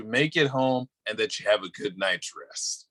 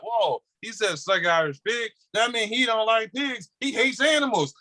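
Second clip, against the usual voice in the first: mock outraged listener; 8 semitones higher than usual. Mocking the people who take his words out of context, high and outraged. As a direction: sneer up high like an offended listener online. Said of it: "gonna be like".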